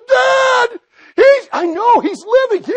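high, loud, bursting with news; excited shout. Acted. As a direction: burst in yelling to your father. Levi shouting to father.

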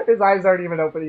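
normal talking voice

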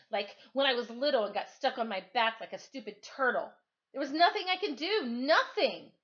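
high, loud, frustrated outburst; building to a high, loud cry; rising frustration. Acted. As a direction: let the helplessness burst out.

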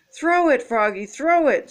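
high, loud urging cry; mock scream. A narrator's reading. Giants urge Froggy to throw, mildly screaming. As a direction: urge the fielder to hurry. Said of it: "screamed the Giants".

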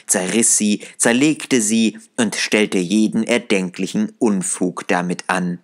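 usual speaking voice

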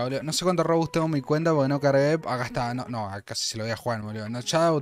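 ordinary delivery

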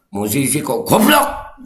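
"goblok" hammered like capital letters; stress on goblok. Shown as a demonstration. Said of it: "saya lagi belajar intonasi baca".

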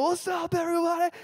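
high, bright-up call across the room; cheery greeting. Retold or imitated. Working the lunchroom, calling out a high greeting. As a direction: greet the whole table with a grin. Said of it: "I'm like".

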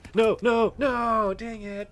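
high, loud repeated no; sudden loss panic; dismayed shouts. Real, not acted. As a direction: cry out as it goes wrong. Dying in game, dismay.

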